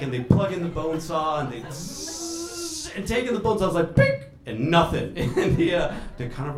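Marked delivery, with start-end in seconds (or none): 1.6-2.9 long high-pitched saw whine, softer; imitated saw whir. Surgeon mimics the bone saw starting up, imitated saw whir. 3.9-4.2 short, very high-pitched ping; a tiny high blip of failure; imitated tool ping. Surgeon mimics the bone saw doing nothing, imitated tool ping.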